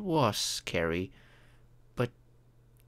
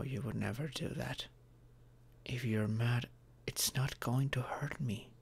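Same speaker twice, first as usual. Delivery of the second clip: soft whispered reassurance; tender whisper; reassuring whisper; 6 dB quieter than usual. Acted. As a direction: whisper it softly to calm them. Reassuring partner about anger.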